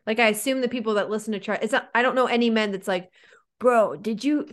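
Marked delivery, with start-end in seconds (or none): 3.6-4.5 lightly voiced bro; a hint of a gym bro; guy voice. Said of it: "that's my guy voice".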